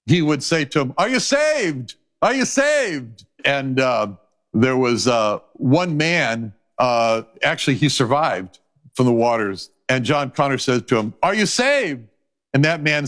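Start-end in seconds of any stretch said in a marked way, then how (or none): 1.0-3.3 higher-pitched, drawn-out call; rescuer calling out. Acted. John Connors asks the dying in the waters, calling out. 11.2-12.1 higher-pitched call with a falling end; lightly acted, urgent question; rescuer calling out. John Connor asks a man in the waters, calling out.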